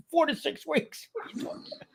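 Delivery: high, incredulous squeak breaking into laughter; laughing disbelief. Retold or imitated. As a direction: balk at the wait in high-pitched disbelief. Mock disbelief at a long wait.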